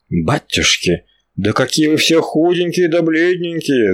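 a mild folk lament over the angels. Acted. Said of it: "запричитала она". (Алёнка жалостливо причитает над ангелами)

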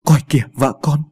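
startled outcry; startled cry. A narrator's reading. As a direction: blurt it out in shock. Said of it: "anh bỗng kêu lên thảng thốt".

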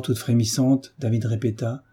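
ordinary delivery, nothing marked

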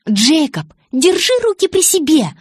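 high, loud, indignant warning; angry rebuke. A narrator's reading. Bella tells Jacob to stop touching her, angry rebuke. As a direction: warn him off sharply, pitch leaping up. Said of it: "прошипела я".

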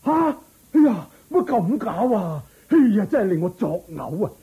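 loud, high-pitched disgusted shock; appalled, wailing disgust; revolted outburst. Acted. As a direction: cry out as if about to retch. Prince revolted on learning coin's origin.

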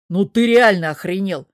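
loud, indignant outburst; mock indignation. A narrator's reading. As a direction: burst out in plausible outrage. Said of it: "Ящер даже правдоподобно возмутился".

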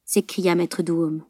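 ordinary delivery

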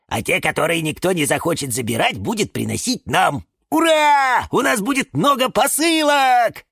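gleeful scheming that bursts into shouting; delighted. A narrator's reading. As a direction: get carried away, ending in a hurrah. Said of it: "обрадовались фикусы".

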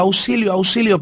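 high, loud cries for help; crying for help. A narrator's reading. Drowning mouse cries for help from the soup. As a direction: shout for help from the boiling pot. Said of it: "El ratoncito gritaba".

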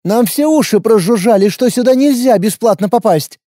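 wary fighter, blunt and guarded; young warrior woman. A narrator's reading. Lina continues.

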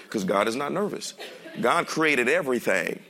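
ordinary delivery

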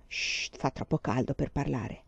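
soft, low, languid hush; drowsy hush. A narrator's reading. Girl in the carriage, hushing him, soft and low. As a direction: murmur it, too hot to talk.

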